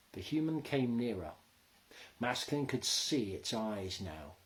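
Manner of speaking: ordinary delivery, nothing marked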